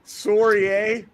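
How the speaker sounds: mock-Canadian with a rounded "Sorry"; Canadian accent. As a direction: put on a polite Canadian accent. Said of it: "you sound like you're Canadian".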